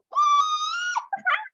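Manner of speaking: shrieking delighted laugh; high giddy laugh. Real, not acted. To her team after teasing the secret, a shrieking laugh. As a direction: burst out laughing with glee.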